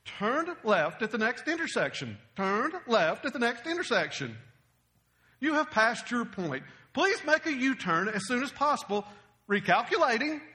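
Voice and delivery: stiff, word-by-word satnav impression; mock automated navigation prompts; GPS voice. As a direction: announce directions like a GPS unit. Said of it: "don't you hate the G P S".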